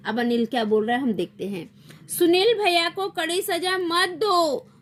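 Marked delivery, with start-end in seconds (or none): 2.1-4.7 high, slow mock whine; tearful pleading. A narrator's reading. Little Anil begging for Sunil, tearful. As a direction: plead like a child about to cry. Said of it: "रोने लगता है".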